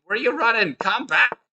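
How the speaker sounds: high, playful call to a runaway; mock calling after. Chess coach to a king on the run, mock urgent call.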